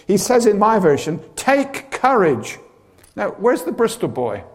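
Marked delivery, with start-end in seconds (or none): 1.3-2.6 slow, steady reassurance; calm the frightened men, slowly; slow, higher take courage; reassuring. Retold or imitated. Quoting Jesus reassuring.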